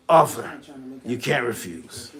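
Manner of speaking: a quick low mob boss echo; Godfather voice. Retold or imitated. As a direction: drop low and quiet for "you can't refuse". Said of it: "ever watched The Godfather".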